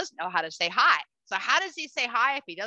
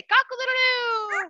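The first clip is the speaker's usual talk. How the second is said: high, slow cock-a-doodle-doo; rooster crow; 13 semitones higher, 5 dB louder, slower than usual. Shown as a demonstration. Trainer cueing the parrot, high rooster crow.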